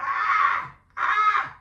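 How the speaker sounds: loud, high comic shriek; mock scream. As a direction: scream at the screen in mock alarm. Mimics reaction to looking at computer.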